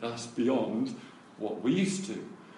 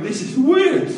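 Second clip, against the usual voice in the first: high, loud cry of disbelief; astonished exclamation; 13 semitones higher, 12 dB louder than usual. Retold or imitated. Kids reacting to the healings, imitated loud astonishment. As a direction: exclaim it as a stunned kid.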